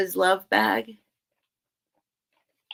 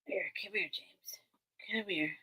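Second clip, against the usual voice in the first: hushed call to a pet; soft whisper to cat; 14 dB quieter than usual. Real, not acted. Streamer whispering to her cat James on her lap, soft coaxing. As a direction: coax the cat over in a whisper.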